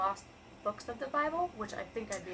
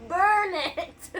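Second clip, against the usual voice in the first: mock shout; 10 semitones higher, 10 dB louder than usual. Joking about destroying the book.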